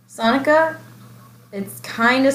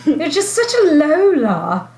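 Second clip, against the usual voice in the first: teasing; 6 semitones higher, 4 dB louder, slower than usual. Pretend. Teasing her sister about the name.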